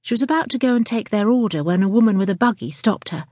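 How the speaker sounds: ordinary delivery, nothing marked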